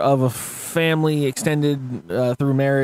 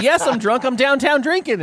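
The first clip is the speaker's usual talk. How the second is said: mock obvious protest; 9 semitones higher than usual. Answering cops in retelling.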